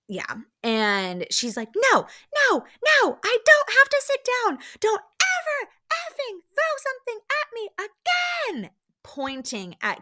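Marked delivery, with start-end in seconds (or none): 1.8-8.7 imitated out-of-control screech, very high; shrieking mock tirade, each word punched; retold screaming. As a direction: mimic someone who has lost control. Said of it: "full on screaming now".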